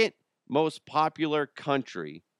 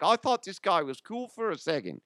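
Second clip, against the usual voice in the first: higher-pitched mock Aussie voice; Australian accent; 6 semitones higher than usual. Offended Australian listener turning on the host, mock Aussie accent. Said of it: "All you Aussies that are like".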